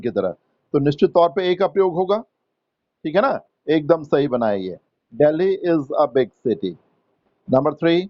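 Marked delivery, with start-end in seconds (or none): 5.1-6.7 each word laid out very slowly; slow, spelled-out classroom dictation. Shown as a demonstration. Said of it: "teacher dictating example sentence".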